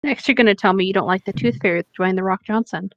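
normal talking voice

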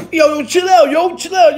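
high, urgent call to stop. Retold or imitated. Inmate calling off the sandwich throwers, urgent. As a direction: imitate hollering at the crowd to ease off. Calls out to calm the attack.

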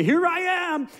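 sudden loud burst. Acted. Rushing into the clearing, startling deer.

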